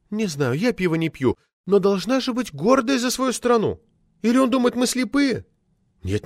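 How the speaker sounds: high, earnest character voice; indignant woman speaker. A narrator's reading. Woman poet defending her country to the committee, high and earnest. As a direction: raise the pitch for proud protest.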